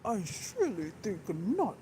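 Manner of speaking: a hint of a too-clear, polite dragon voice; lightly mock the prim male narrator; imitating clear narrator. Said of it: "Tannen's voice in the graphic novel".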